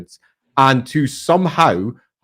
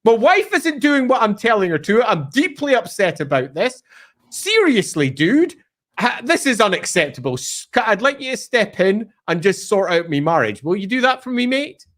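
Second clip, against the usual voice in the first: hammed-up aggrieved husband, pitched up; Samael; 5 semitones higher than usual. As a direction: whinge to a mate about your wife. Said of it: "So Samael, one of the most powerful".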